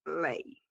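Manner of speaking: "lei" set apart after a pause, falling softly; isolated stressed syllable. Shown as a demonstration. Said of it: "a sílaba mais forte é".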